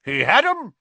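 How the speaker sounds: high, loud, proud announcement; boastful knight's cry; Sir Cadogan. Acted. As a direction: declare it with blustering pride. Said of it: "said Sir Cadogan proudly".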